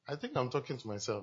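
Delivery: quiet aside with a laugh, said half to himself; chuckling aside. Real, not acted. Preacher muses to the congregation, chuckling softly.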